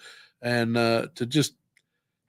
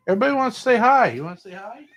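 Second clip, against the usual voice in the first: pet talk; 11 semitones higher, 3 dB louder, much faster than usual. Real, not acted. Coaxing cat to greet viewers.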